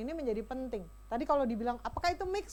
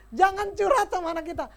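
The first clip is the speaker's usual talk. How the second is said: ordinary talk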